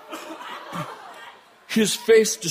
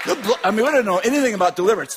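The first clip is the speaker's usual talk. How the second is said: ordinary talk, nothing marked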